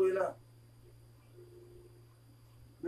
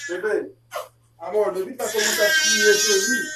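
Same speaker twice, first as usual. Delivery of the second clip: exasperated calling out; 9 dB louder than usual. Real, not acted. Parent calling partner about the crying, loud and exasperated. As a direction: raise your voice sharply to be heard.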